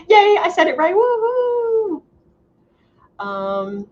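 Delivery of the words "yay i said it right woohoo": excited cheer. Real, not acted. Cheering getting a name right.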